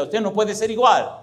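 high, emphatic preaching peak; emphatic climax. Real, not acted. Preacher urging the congregation to change, emphatic.

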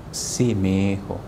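low, slow, weak yes; a lightly voiced dying mother; mother on her deathbed, low and slow; frail dying mother.

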